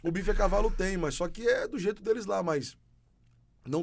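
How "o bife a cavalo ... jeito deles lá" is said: loud, high burst through laughter; real laugh breaking into the words; amused, laughing. Real, not acted. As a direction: answer while laughing out loud. Amused reply to the other's question.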